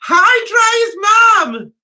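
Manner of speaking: calls out loud and high; shouted greeting. Real, not acted. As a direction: hail the viewer across the room. Calling out to a viewer.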